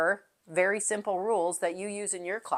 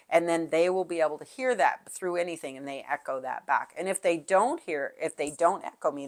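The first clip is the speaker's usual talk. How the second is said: ordinary talk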